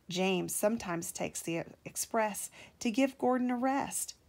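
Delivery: ordinary delivery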